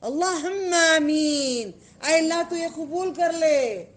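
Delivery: slow, pleading amen with a catch of tears; calling on God. Shown as a demonstration. Teacher shows how to call on God, slow and pleading. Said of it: "اللہ کو پکار کے آمین بولو".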